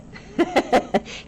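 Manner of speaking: high, airy burst of giggles; amused teacher's giggle. Real, not acted. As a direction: laugh at your own example. Amused by name Billy Boy.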